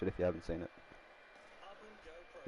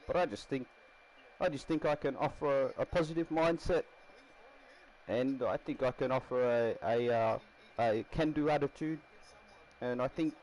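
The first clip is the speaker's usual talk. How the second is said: put-on Kiwi interviewee, pitched up; Kiwi accent; 4 semitones higher than usual. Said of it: "I don't know why I'm Kiwi".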